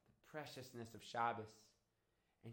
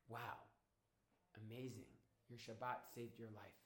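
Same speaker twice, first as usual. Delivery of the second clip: soft, slightly lowered wonder; hushed awe; 3 semitones lower, 9 dB quieter than usual. Acted. Woman realising Shabbat saved Dodi's life, hushed awe. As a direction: let the amazement sink in softly.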